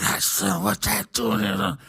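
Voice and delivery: put-on slow, falling old-man drawl. Storyteller redoes how the old men said it, slow old-man drawl. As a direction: imitate a doddering old billionaire, dragged out. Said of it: "it was like more like".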